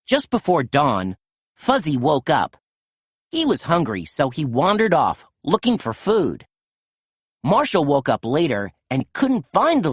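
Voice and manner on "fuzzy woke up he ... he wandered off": ordinary delivery, nothing marked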